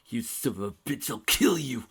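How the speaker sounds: dragon roaring in rage; 스파이크가 분노로 포효하듯. A narrator's reading. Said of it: "roared Spike".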